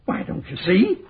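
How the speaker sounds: ordinary delivery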